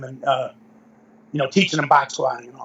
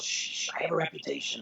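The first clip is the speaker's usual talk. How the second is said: whispered shush; 12 dB quieter than usual. Retold or imitated. Teller shushing friend who corrects him, retold whisper. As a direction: shush a friend to protect your image.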